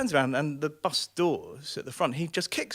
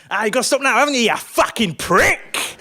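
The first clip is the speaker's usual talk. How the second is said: angry taunting; 7 semitones higher, 10 dB louder than usual. Acted. Passenger insulting driver at the red light, angry jeer. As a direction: jeer it at full volume.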